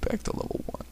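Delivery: faint, whispered last words; weak, voiceless collapse; weakly. A narrator's reading. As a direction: barely get it out, fading. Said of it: "Konata said weakly before falling over".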